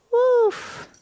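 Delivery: high, loud mock bark; playful dog woof. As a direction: bark like a happy puppy.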